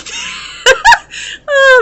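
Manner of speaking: loud high cackle; high burst of laughter. Real, not acted. Reader cracking up at the client's seriousness, loud laugh. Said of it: "I'm just laughing".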